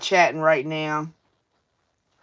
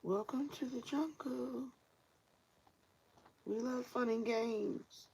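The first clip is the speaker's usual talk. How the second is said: breathy whispered, slow and soft; 6 semitones higher, 18 dB quieter than usual. Pretend. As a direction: whisper the song line teasingly. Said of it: "whispered song line".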